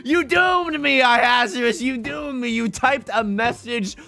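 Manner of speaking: high, loud, hammed-up accusation through laughter; mock outrage. Blaming a chatter for the phrase, hammed-up outrage. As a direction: rail at the chatter in playful fury.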